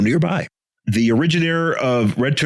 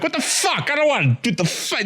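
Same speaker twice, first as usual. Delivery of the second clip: high, squeaky complaining voice; high mocking whine; mock whiny Green Arrow; 13 semitones higher than usual. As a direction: whine it like a petulant hero.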